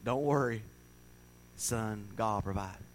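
soft, low, swallowing fear; quietly steady the boy despite dread; forced calm reassurance. Retold or imitated. Mother hiding her dread from her son, soft and low. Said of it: "force herself to say".

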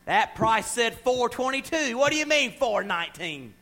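slow, indignant haggling over a price; mock indignant customer at the till; mock angry customer. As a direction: play the huffy shopper arguing the price. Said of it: "We'll show our hind ends in Walmart".

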